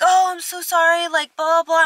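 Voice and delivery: high, gushing mock apology; imitating a flustered apology; high apologetic friend voice. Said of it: "she's like".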